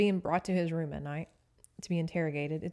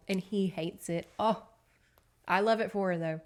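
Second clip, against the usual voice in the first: usual speaking voice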